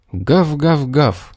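high, snappy dog barks; fierce barking. A narrator's reading. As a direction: bark like an angry yard dog. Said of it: "яростно залаяла собака".

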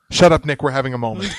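normal talking voice

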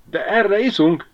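high-pitched, excited cry; exclaiming. A narrator's reading. Ribiszke after laughing at the story, exclaiming. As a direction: cry out a call to drink. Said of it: "kiáltotta a végén".

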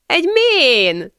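high, drawn-out delighted cry; laughing exclamation. A narrator's reading. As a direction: stretch it out high, amused. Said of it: "nevetett fel ostor".

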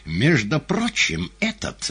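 high, slow, drawn-out address; showman figure. A narrator's reading. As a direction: announce it grandly to the house.

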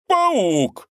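high, startled exclamation; sudden cry. Acted. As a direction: shout it out suddenly. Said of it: "вдруг воскликнул".

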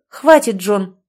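sharp interruption. A narrator's reading. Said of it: "прервала мужчину".

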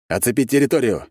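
commanding shout; shouted order. A narrator's reading. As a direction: call out an urgent order. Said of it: "крикнул я".

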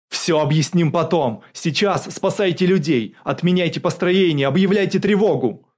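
loud, high string of urgent orders; shouting. A narrator's reading. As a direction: shout at the general to act now. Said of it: "крикнул Богданов". (кричать срочные требования)

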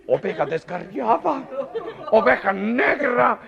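high, loud, slow scandalised father; father scolds his daughter, hammed-up outrage; mock outrage. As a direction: bellow it like a melodramatic patriarch.

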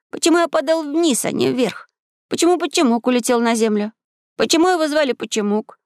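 put-on high-pitched boyish questions; high, insistent questioning; boy questioning boldly. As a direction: fire off whys in a high young voice. Boy fires bold questions aloud.

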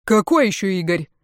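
exasperated, understated flare of anger; losing temper. A narrator's reading. Pianist questions his ex-wife, losing patience. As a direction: demand it irritably. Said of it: "вконец вышел из себя пианист".

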